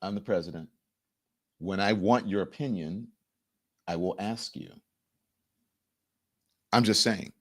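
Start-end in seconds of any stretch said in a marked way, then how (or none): 0.0-4.7 quiet put-down. Retold or imitated. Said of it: "wouldn't you be like".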